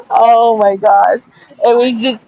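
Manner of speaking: slow, drawn-out amused sigh; laughing recovery. Real, not acted.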